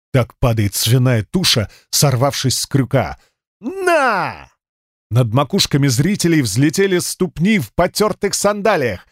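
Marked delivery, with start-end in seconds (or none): 3.6-4.5 sharp grunt with a punch; high effort grunt. Acted. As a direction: grunt out the blow. Blow delivered with grunt.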